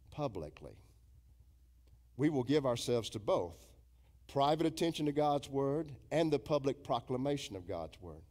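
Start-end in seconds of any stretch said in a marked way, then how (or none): none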